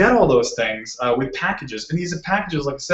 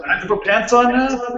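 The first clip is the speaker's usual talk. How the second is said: mock surprise; 10 semitones higher than usual. Real, not acted. Mock protest at host.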